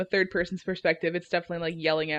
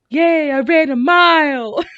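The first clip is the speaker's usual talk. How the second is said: mock gleeful cheer, slow and loud; 8 semitones higher, 10 dB louder, slower than usual. Jogger passing their coffee table, mock gleeful cheer. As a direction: imitate a jogger's little victory cheer. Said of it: "quiet but gleeful, like, excited voice".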